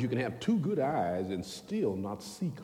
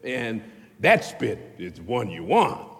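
usual speaking voice

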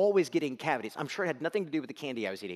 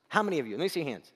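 normal talking voice

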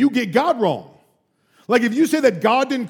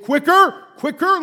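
higher, louder urging; pressing professor; 9 semitones higher, 4 dB louder, slower than usual. Retold or imitated. Professor pressing his student to answer faster, urgent. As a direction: rush him, push harder. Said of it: "he was forcing me".

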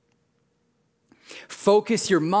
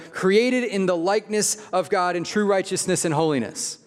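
usual speaking voice

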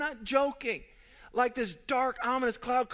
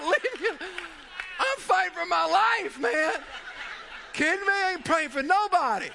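frantic laughing yell; 7 semitones higher than usual. Retold or imitated. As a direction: yell it while fighting the water. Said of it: "I said".

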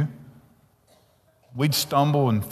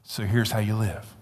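normal talking voice

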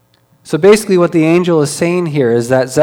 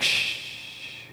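soft unvoiced shh; shushing; 15 dB quieter than usual. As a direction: hush him with a long shh. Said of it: "Gabriel shushing Zechariah".

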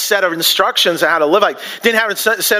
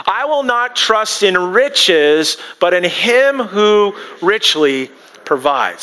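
slow, deliberate, stressing will and him; slow affirmation; much slower than usual. Shown as a demonstration. Pastor leading the congregation in an affirmation, slow and deliberate. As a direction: recite it slowly for them to repeat. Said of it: "Can you say that with me?".

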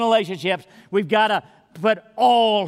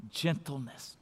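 much softer, tender delivery; soft gentle voice; 4 semitones lower, 12 dB quieter, slower than usual. Shown as a demonstration. Pastor modelling gentleness for the congregation, hushed and tender. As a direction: say it softly, like soothing a child. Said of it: "a gentle tone of voice".

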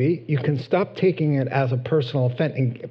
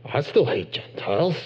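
sullen grumble; 6 semitones lower, 4 dB quieter than usual. Retold or imitated. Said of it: "your friends in the room are like".

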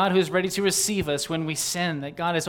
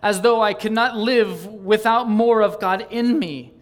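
higher, louder, pleading cry to God; fervent devotional reading; 6 semitones higher, 6 dB louder than usual. Acted. Preacher reads out a yearning confession to God, fervent. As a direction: plead with longing, stretching the final cry.

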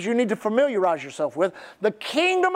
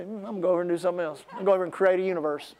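quick, low, softer throwaway line; offhand God walking away; 5 semitones lower, 4 dB quieter, much faster than usual. Acted. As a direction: play God losing interest and wandering off. Voices God casually walking away.

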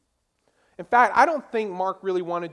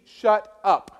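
stern rebuke; 7 dB louder than usual. Retold or imitated. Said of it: "his response is to the sea".

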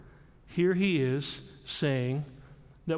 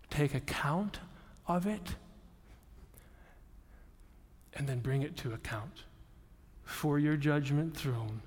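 quiet prayer; 4 semitones lower, 8 dB quieter than usual. Retold or imitated. Voicing a surrendering prayer to God.

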